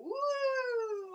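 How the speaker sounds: soaring woo; high loud woo. Acted. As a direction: whoop him up into the air. Troll flies in air.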